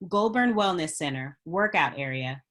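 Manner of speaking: ordinary talk, nothing marked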